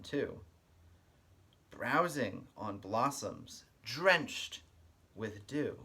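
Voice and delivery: lively storybook read-aloud with a sing-song lilt; reading to children. Acted. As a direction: read it like story time for little kids. Reads picture book aloud.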